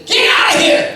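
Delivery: high, loud hammed-up yell; mock angry yell. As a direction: bark it like someone furious with you. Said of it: "get angry with you".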